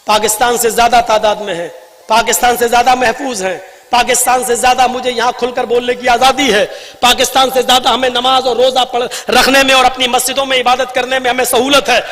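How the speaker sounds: impassioned rally speech with ringing emphasis; fiery oratory. Real, not acted. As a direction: address the crowd like a fiery orator. Impassioned political speech to a crowd.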